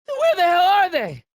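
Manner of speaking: panicked high shout. Acted. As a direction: cry out in alarm looking around. Panicked search for missing car.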